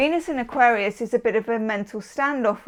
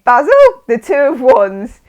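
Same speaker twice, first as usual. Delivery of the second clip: loud, higher call of the name; Fawlty Towers call; 3 semitones higher, 11 dB louder than usual. Retold or imitated. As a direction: belt out Basil like a sitcom wife. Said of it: "talking to Mister Fawlty".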